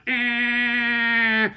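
long, high held honk; car horn imitation.

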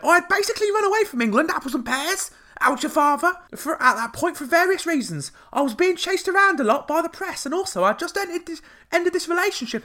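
put-on Cockney accent. Host mocks the singer's interview in hammed-up high Cockney. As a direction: ham up a chirpy Cockney impression. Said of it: "I won't do the Cockney accent again".

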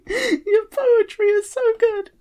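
rising into a high, crying gush; tearful praise. Retold or imitated. Proud of the character, a mock tearful gush. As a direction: get choked up as you go. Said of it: "'cause I'm like".

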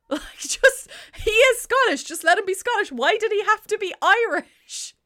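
high, loud pleading protest; worked-up, high-pitched complaint; exasperated. Real, not acted. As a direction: argue it in comic outrage. Exasperated complaint about Irish accent.

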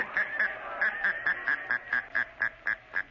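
long, descending mocking cackle; staccato laugh stepping down in pitch; sinister laugh. The Shadow unnerves hidden gunman, sinister laugh. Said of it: "Who laughed like that?".

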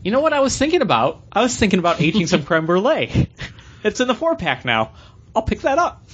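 high, mock-innocent eager self-quote; retold bright idea. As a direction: act out yourself having the same happy idea. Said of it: "and I'm like".

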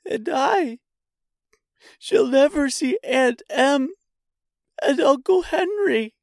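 put-on very high voice of a girl near tears; high, halting, tearful lament; tearful Dorothy. A narrator's reading. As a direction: begin to cry, pitched right up. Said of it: "beginning to cry".

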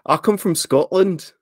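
amateur Scottish, stretched and lifted; Scottish accent. Shown as a demonstration. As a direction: put on a careful Scottish accent. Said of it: "say in a Scottish accent".

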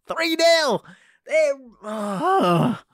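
high, loud cry of disbelief; exasperated cry. Acted. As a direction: wail the score out in despair. Despairing over a football result.

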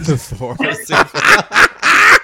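loud, high, drawn-out laughing repeat; laughing echo. Real, not acted. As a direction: burst out laughing while echoing the title. Laughing echo of guest's phrase.

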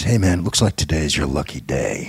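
low, confidential New York bartender; bartender tip-off. Acted. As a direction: pitch it well down, like a barman tipping off a customer. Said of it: "this bartender leans back over".

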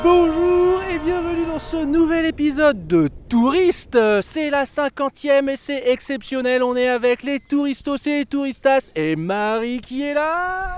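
high, slow announcer intro; show host announcer. Acted. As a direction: welcome listeners like a radio host.

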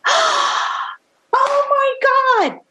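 big gasp, then very high drawn-out squeal; ecstatic disbelief. Retold or imitated. Singer hearing [name] will play on her record, ecstatic. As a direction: replay being starstruck, slow and sky-high.

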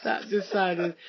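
ordinary talk, nothing marked

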